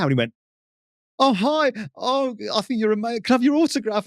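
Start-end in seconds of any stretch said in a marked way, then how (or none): 1.2-4.1 high, gushing fanboy voice; suddenly sweet and high, fawning over the star; a heckler turned starstruck fan in front of the footballer. Retold or imitated. Fan meets the footballer he insulted.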